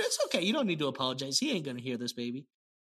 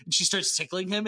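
ordinary talk

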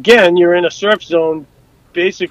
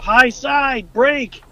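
higher-pitched imitation of a yelled warning; retold yell; 7 semitones higher, much slower than usual. Crewman warning the helmsman of a wave, retold yell. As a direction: call it out like a crewman's alarm, not full volume. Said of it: "somebody yells out".

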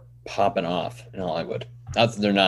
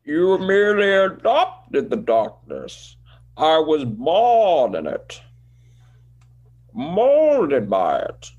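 slow, swooping higher villain voice; Bane; 5 semitones higher, slower than usual. Acted. Host shows off his Bane to co-host, theatrical villain impression. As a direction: draw the words out with grand, swooping pitch. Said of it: "now listen to my Bane".

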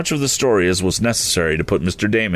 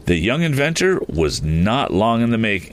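ordinary delivery

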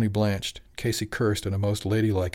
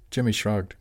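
usual speaking voice

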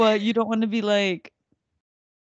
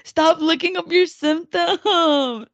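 slow, higher, laughing through it; slow laughing reprimand; laughing scold; 7 semitones higher, 5 dB louder, much slower than usual. Real, not acted. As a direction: teasingly tell her off while cracking up.

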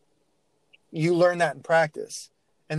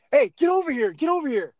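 high-pitched, insistent sideline call; coach yelling; 10 semitones higher, 3 dB louder than usual. Acted. Coach calls a player over after a mistake, yelling. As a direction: act out an upset coach yelling, pitch jumping up. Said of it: "coach is yelling".

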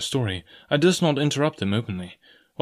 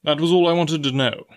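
higher, carrying voice; doctor covering with a raised voice; quite loudly; 5 semitones higher, 4 dB louder than usual. Acted. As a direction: speak up so others overhear. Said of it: "said he quite loudly".

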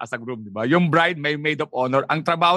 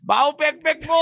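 mock yell; 8 semitones higher, slower than usual. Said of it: "masisigaw mo ba sa mall yun?".

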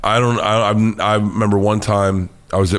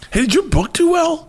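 much higher, excited mock Joe; imitating Joe. Retelling how Joe asked him, in a high mock Joe voice. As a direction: pitch way up to play Joe's amazement. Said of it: "I remember watching him say it, like".

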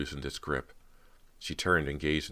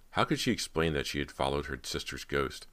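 usual speaking voice

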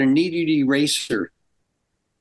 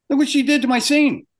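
high, wound-up protest; retold outburst, pitched far above normal; losing it; 13 semitones higher, faster than usual. Animator protesting his erased scene to boss, losing it. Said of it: "I lost it".

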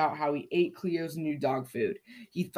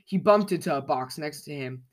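ordinary delivery

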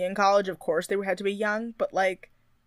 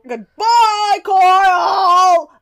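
yelled catchphrase, high and loud; shouting; 11 semitones higher, 12 dB louder, much slower than usual. Pretend. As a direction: belt it out at the top of your lungs. Both yell catchphrase together.

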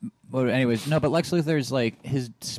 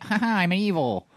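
high, hammed-up villain gloat; mock movie villain; 9 semitones higher, 4 dB louder than usual. Mocking how movies play Lex, hammed-up villain. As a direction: send up a cartoonish evil laugh and boast. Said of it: "he's always just like".

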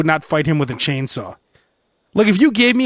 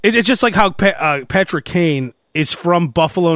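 normal talking voice

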